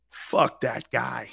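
slow, lightly voiced scornful aside; muttered dismissal. Wife sneering as the coach walks away, slow contempt. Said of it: "she goes like this".

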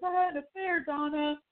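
a hint of Gordon blurting it out; quoting Gordon's confession.

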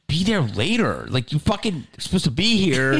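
indignant echo with swinging pitch; retold incredulity. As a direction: throw the words back in disbelief. Throws the excuse back, incredulous.

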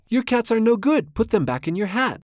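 high-pitched, scolding protest read aloud; animated, mildly yelled complaint; storybook yell. A narrator's reading. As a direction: order the cat about in a lightly acted yell. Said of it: "we yelled at the cat".

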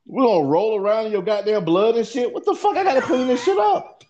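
frantic high-pitched yelling to get her out before the wife comes; angry yell. Retold or imitated. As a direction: chew her out, voice cracking up high.

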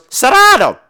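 high, loud, brusque refusal; caricatured high-pitched shout; mock gym owner. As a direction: imitate her turning a customer away, high and loud. Acted back-and-forth quarrel.

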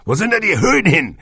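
plain reading voice

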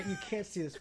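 ordinary delivery

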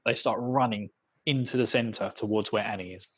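ordinary talk

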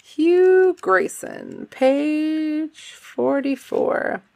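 slow, drawn-out high announcement; dramatic announcement. Pretend. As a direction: stretch it out grandly. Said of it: "announcing quote dramatically".

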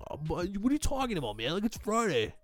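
put-on high, put-upon teenage whine; high-school kid whining at the boss; whiny teen protest. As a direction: whine like a teen denied Friday night. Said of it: "and I was like".

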